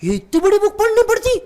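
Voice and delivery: dad gasping at the size of the tax book; amazed question. Retold or imitated. (बहुत ऊँची आवाज़ में हैरानी)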